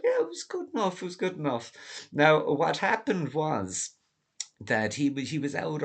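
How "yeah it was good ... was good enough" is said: lightly mocking old dad, much higher; hammed-up high dad voice, shrugging it off; Doomer Dad, mock voice. Son mimics his father's lukewarm movie review, high mock voice. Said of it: "And he's got".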